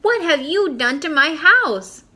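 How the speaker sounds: high, loud, slow outraged cry; upset shout. Acted. Boy shouting down at the new kids, high outraged cry. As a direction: demand an answer, hurt and upset.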